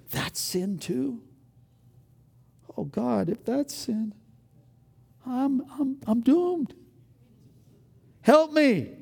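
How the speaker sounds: rising, pleading mock alarm; mock panic. Preacher acting his younger self pleading with God, mock panic. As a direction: beg for help, half-comic dread.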